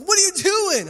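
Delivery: mock alarmed wife. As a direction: demand an answer like a startled wife. Wife scolds husband.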